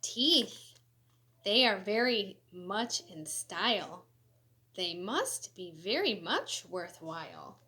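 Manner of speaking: lively, sing-song storybook reading; animated picture-book read-aloud; reading to children. Acted. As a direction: read it bright and bouncy for kids.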